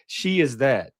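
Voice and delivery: ordinary delivery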